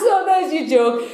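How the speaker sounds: high, through real laughter; laughing. Real, not acted. Presenter closing her chimp joke, still laughing. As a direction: get it out while still cracking up.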